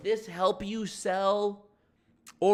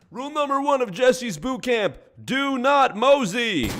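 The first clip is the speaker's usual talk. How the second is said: high, loud rule announcement, slowing at the end; mock drill instructor; 5 semitones higher, 4 dB louder than usual. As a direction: lay down the boot-camp law, mockingly. Mock boot camp rule announcement.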